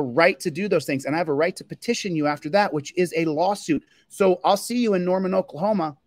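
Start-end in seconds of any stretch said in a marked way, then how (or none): none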